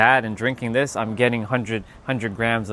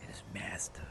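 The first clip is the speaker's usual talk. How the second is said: whispered; 5 semitones lower, 22 dB quieter than usual. Shown as a demonstration. Streamer whispering the pronunciation to chat. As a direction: whisper it like a secret. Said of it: "I'm gonna even put some emphasis on that".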